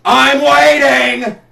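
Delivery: loud, slow, mocking impatience; loud sarcastic call. Real, not acted.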